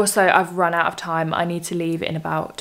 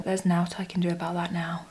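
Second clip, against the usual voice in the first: whispered aside; breathy unvoiced whisper; 8 dB quieter than usual. Real, not acted. Aside about looking pale.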